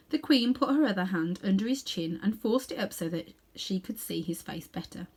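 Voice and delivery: ordinary delivery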